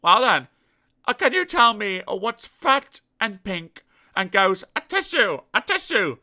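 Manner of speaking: spring the trick gently; sly Mister Dizzy. Acted. Mister Dizzy quizzing the pig, sly.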